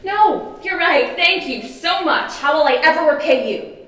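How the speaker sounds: high, overdone mock thanks; gushing, scornful sweetness; sarcastic gratitude.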